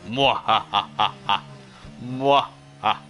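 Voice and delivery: slow theatrical villain menace; evil cackle, drawn out; dastardly laugh. Acted. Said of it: "We've got our dastardly laugh".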